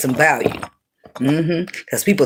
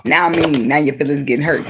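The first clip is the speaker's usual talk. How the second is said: normal talking voice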